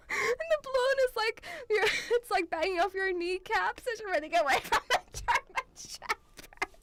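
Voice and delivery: high, loud, talking through laughing fits; helpless laughter. Real, not acted. Picturing balloon banging off kid's kneecaps, helpless laughter. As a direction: squeal it out while cracking up.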